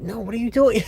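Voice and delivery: high, disbelieving squeak; high, mock bewildered protest; incredulous. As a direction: act baffled at a crazy plan. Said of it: "And he's like".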